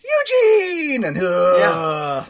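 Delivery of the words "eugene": high, loud shout of a name; shrill childhood call for Eugene. Retold or imitated. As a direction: yell the name across the house like a kid. Calling out as childhood sister.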